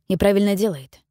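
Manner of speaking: usual speaking voice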